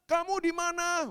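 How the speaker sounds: high, loud father's call; drawn-out searching call. Retold or imitated. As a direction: shout for a child in another room. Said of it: "Saya panggil".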